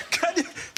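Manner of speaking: Kanye said high and drawn out, copying him; mimicking Kanye pronunciation. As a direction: echo how he said Kanye. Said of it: "the way you pronounce [name] is incredible".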